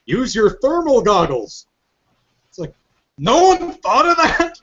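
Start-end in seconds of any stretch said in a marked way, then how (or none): 0.1-1.7 mock commander. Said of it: "And then one commander goes". 3.2-4.6 very high, drawn-out disbelief; mock incredulity. Mocking guards in a game trailer, comic incredulity.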